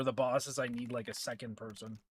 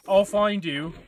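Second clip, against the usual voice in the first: louder, higher mock threat; Genie; 6 semitones higher, 10 dB louder than usual. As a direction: put on a friend's voice, raised and menacing. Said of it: "And Genie's gonna say".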